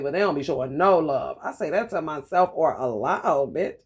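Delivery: normal talking voice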